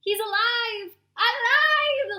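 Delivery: frantic gleeful exclaim; excited exclaim. Acted. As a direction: cry out like mad scientists. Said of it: "the little terrors exclaimed".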